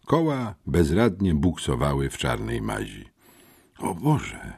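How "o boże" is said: higher, choked exclamation; mildly stunned and choked; choked-out shock. A narrator's reading. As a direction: gasp it out, stunned, without raising the volume. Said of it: "wykrztusiłem".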